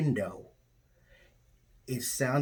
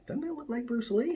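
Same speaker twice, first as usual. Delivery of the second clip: squeaky high mock voice for Shemar; lightly voiced astonished friend; impression of Shemar Moore; 13 semitones higher than usual. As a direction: pitch way up for the friend's wonder. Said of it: "he's like".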